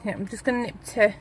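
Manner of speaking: normal talking voice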